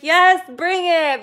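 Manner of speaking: hammed-up joyful challenge; mock eager welcome. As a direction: welcome the nausea like a prize, loud and drawn out. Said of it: "I'll be like".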